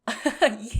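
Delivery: loud bright laugh bursting out; burst of laughter. Acted. Laughing hard with a companion over a joke.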